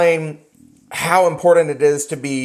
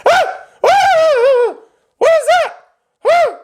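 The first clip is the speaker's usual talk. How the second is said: much higher, loud shrieking screams; horrified scream; 5 dB louder, much slower than usual. Retold or imitated. Husband at closing finding the junk fridge, screaming in horror. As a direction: scream like he's seen a monster. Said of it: "this is all you hear".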